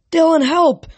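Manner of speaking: high loud cry for help; shouted for help. A narrator's reading. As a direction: scream for help over the wind. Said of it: "Piper shouted".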